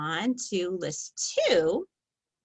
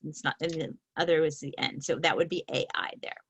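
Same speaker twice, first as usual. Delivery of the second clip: normal talking voice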